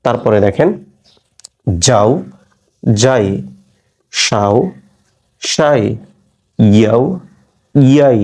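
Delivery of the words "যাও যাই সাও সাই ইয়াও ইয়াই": যাও যাই ইয়াও ইয়াই from the hard palate; tongue pressed further back for ইয়া; palatal sounds. Shown as a demonstration. Teacher demonstrating palatal sounds, isolated syllables. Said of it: "তালব্য অংশ থেকে".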